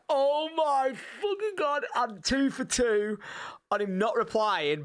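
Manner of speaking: high, slow, drawn-out disbelief; exasperated inner groan. Retold or imitated. Ignored by the actor again, exasperated and drawn out. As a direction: stretch it out like you can't believe it.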